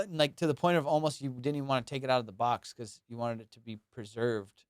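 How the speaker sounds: ordinary talk, nothing marked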